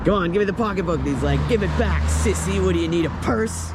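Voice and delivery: mock robbery argument. Vlogger retelling a staged purse snatching, mock robbery argument.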